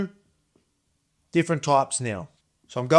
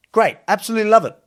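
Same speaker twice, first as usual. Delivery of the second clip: excited; 7 semitones higher, 4 dB louder, faster than usual. Real, not acted. Enthusing about Vegemite.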